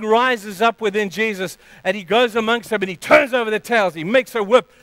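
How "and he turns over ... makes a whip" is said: ordinary talk, nothing marked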